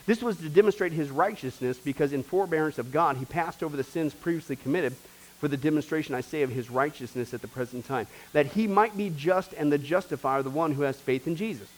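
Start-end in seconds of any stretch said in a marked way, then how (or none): none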